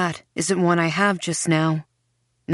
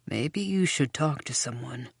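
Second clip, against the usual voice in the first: gentle; 5 semitones lower, 7 dB quieter than usual. A narrator's reading. Goblin gentle advice.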